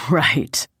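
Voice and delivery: small chuckle into the word; chuckled. A narrator's reading. Man amused by his girlfriend's parroting, chuckled. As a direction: let a light laugh lead it. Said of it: "he chuckled".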